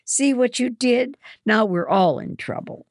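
loud, high furious accusation; blaming them at the top of her voice; angry girl yelling blame. A narrator's reading. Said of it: "Hannah says furiously".

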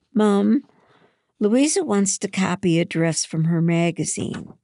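eager appeal. A narrator's reading. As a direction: pipe up on a friend's behalf. Daughter eagerly asks Mom a favour.

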